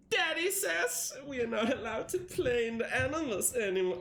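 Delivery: lightly voiced young child. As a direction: voice it like a little kid telling on Daddy. Reading a child's note aloud.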